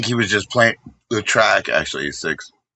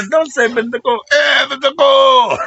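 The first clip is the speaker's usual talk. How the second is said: much higher and louder, cracking up mid-yell; laughing shout; 4 dB louder than usual. Real, not acted. Streamer cracking up at his own joke, laughing shout.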